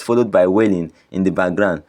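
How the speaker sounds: ordinary delivery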